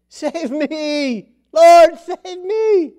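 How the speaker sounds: high, loud, slow cry for rescue; desperate cry. Acted. Peter sinking in the waves, crying to Jesus in panic. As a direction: cry out like a man going under.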